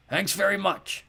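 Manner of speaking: high cracked sarcastic croak; mock gratitude, grumpy old creature. Skellig sarcastic to the boy, cracked.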